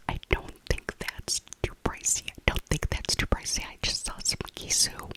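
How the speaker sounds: ordinary talk, nothing marked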